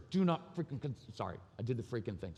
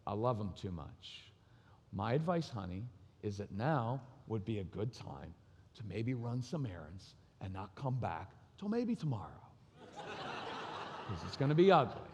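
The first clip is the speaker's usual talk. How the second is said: calm and knowing; 5 semitones lower, 6 dB quieter than usual. Retold or imitated. Husband advising his wife to leave before the fight, calm and soft. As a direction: calmly warn her, half laughing. Father calmly answers wife.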